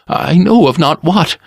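bow and scrape with the voice; servile barber. A narrator's reading. Barber flatters his dangerous customer, servile.

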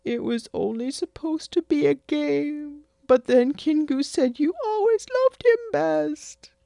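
put-on high childish pleading voice; whiny little boy explaining. Son pleading with his mother, high and childlike.

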